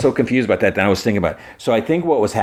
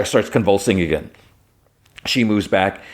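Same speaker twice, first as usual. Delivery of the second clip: plain reading voice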